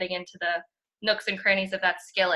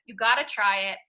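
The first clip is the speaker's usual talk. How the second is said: ordinary talk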